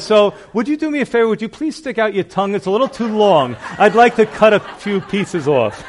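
fast gleeful retelling with laughter; laughing punchline. Retold or imitated. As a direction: deliver the pastor's zinger while cracking up. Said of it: "He says".

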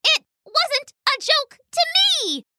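very high, tiny indignant mouse; shouting mouse. A narrator's reading. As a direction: protest in a squeaky, high mouse voice. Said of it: "shouted the mouse".

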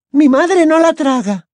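ordinary delivery, nothing marked

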